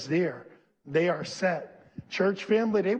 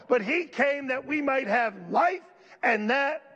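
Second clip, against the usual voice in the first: full-force preaching; 7 semitones higher than usual. Acted. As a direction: preach it hard to the room.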